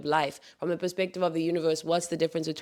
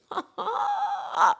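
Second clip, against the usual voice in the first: bright laugh much higher. Real, not acted. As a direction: laugh out high with delight. Laughs at her own phrase.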